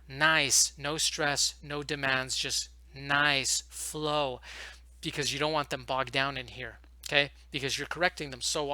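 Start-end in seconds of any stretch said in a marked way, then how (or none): 0.1-4.4 drawn-out, easy-flowing words with space between; slow soothing tone. Shown as a demonstration. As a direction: stretch each phrase calmly, no pressure on the student. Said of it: "soothing tone".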